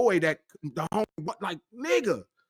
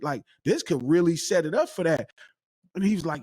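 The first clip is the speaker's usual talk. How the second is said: usual speaking voice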